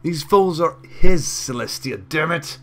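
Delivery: building to a furious high shout; angry mare. Acted. Wife furiously proving to the town, building to a shout. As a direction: rage at the gossiping town. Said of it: "The angry mare".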